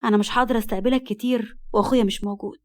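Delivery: usual speaking voice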